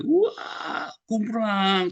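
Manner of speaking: high, loud burst on the fall; loud cartoon crash call; mock crash. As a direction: yelp it out as something crashes down. Acting out a fall.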